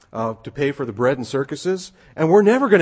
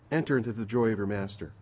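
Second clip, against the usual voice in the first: soft repeat; 4 semitones lower, 7 dB quieter than usual. Acted. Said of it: "See what he says".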